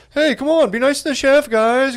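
high, pleading "come on"; mock Tom Hanks. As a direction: plead nicely, hammed up. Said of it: "being like".

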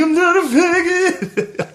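very high, slow wailed denial; mock tearful retort. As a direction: imitate yourself bawling back at dad. Retold tearful retort to his father.

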